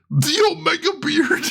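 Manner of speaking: high, amazed cry; excited exclamation. Real, not acted. Streamer spotting the judge's beard, excited exclamation. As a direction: announce it high, as a thrilling sight.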